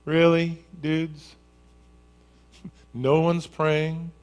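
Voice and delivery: lightly voiced, playful teasing Jesus; a hint of an amused Jesus calling over the waves; Jesus' familiar voice. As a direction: gently tease the scared disciples. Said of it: "they heard this familiar voice".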